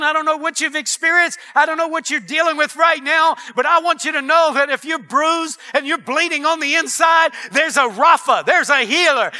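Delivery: rising revival-style proclamation; impassioned preaching. Real, not acted. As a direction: drive each phrase home to the congregation. Preaches, building to full force.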